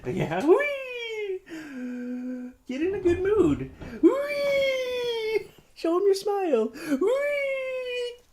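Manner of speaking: very high, playful squeals of whee. Real, not acted. Dad swinging his fussy baby, squealing whee very high.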